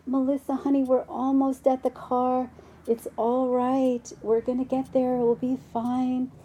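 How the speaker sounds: high, soothing mother voice; gentle mom. Retold or imitated. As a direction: patiently reassure an unruly child. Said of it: "very gently and kindly says".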